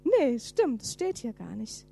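normal talking voice

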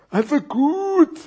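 very drawn-out, loud concession; high character groan; groaning fat scarf. Acted. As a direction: give in with a long, hammed-up moan. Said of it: "stöhnt der dicke Schal".

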